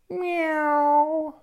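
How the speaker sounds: long, high kitty meow; cat meow. Acted. Joey shows what a cat sounds like, meowing. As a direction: mew like a cat, drawn out. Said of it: "a kitty cat goes".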